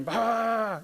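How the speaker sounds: a sudden high barked "Bah!"; hammed-up angry outburst; mock yell. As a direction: burst out with a retold angry yell. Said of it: "there'd be yelling".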